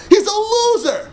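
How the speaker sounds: hammed-up whiny taunt; mock wail. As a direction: cry it out in mock pity. Mocking the failed rationalist.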